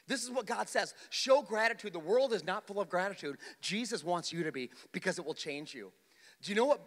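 high, loud pulpit preaching; full-force preaching. Acted. Pastor preaching hard to his congregation, loud and high. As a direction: preach it out to the room.

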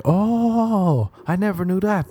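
high airy mock-clueless voice, pitched well up; ditzy friend. Friend who forgets everything, mock-clueless and high. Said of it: "she'd be like".

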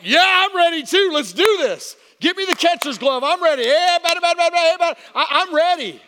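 loud, high, hammed-up eagerness; pumped-up hollering. Husband telling doctor he is ready, hammed-up excitement. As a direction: yell it like a player psyched for the game. Acting his own pumped-up reply.

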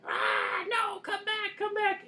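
very high, loud, manic yelping dog. Retold or imitated. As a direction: squeal it like a frantic, angry little dog. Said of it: "in dog voice".

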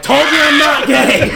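high, loud joking shout; mock triumphant yell. Friend jokes about proving it to mama, mock triumphant yell. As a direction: yell it out proudly, on the edge of laughter.

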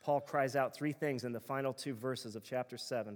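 normal talking voice

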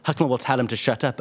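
plain reading voice

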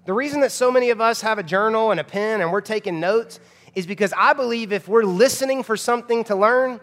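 normal talking voice

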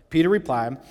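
ordinary talk, nothing marked

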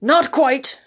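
loud and high from up in a tree; teasing call from the hidden man; voice from the leaves. A narrator's reading. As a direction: call it down from the branches. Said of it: "answered an obscure voice among the leaves".

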